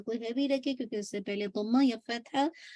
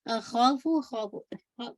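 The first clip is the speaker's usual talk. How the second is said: ordinary delivery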